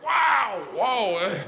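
high, awed exclamation; amazed gasp. Retold or imitated. Retelling seeing the moose, high amazed shouting. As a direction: gape at it like a film set. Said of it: "I was like".

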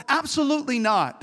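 normal talking voice

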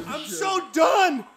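high, slow fed-up whine; totally done with it; exasperated. Real, not acted. Exasperated outburst.

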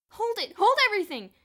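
high loud breathless halt; gasped shout. A narrator's reading. Bruno halts Boots mid-flight with a loud gasp. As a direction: stop everyone dead in their tracks. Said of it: "Bruno gasped".

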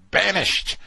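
high, loud pronouncement of banishment; mock stern decree. Host mimicking plantation-style punishment of children, mock stern and loud. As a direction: pass sentence like an overseer, hammed-up.

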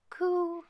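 very high little "Coo."; the baby ghost cooing for the crowd. A narrator's reading. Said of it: "the baby ghost ... say".

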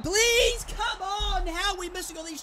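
pleading yell, very high; desperate high shout. Real, not acted. Gamer begging his striker to score, high shout. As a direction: beg the ball into the net.

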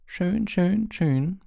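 high, sing-song portrait lady voice; fluting high painted lady; Fat Lady. Acted. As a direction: coo it in a lilting high voice. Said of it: "sagte die fette Dame".

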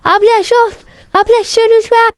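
high, desperate pleading; begging with a burning mouth. Real, not acted. Begging sister for water.

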